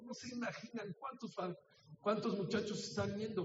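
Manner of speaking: ordinary talk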